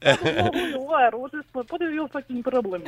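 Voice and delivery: indignant mock Russian; fake Russian accent. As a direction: act affronted in a Russian accent. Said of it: "I tried pretending to be Russian".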